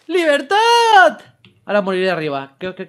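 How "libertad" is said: high, loud, long-held shout; triumphant cry. Acted. Gamer proclaims freedom in the game, triumphant cry. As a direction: bellow it like a battle cry.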